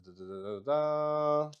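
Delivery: normal talking voice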